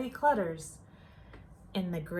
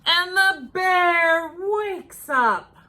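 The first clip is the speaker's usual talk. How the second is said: slow, louder, higher; the bear wakes, slow and loud; dramatic reveal; 7 semitones higher, 7 dB louder, slower than usual. A narrator's reading. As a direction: announce it like thunder.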